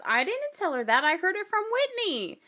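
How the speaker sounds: mock indignant, rising high at the end; put-on protest; mock defensive denial. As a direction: imitate someone protesting innocence, pitch climbing. Acting out Meredith's denial.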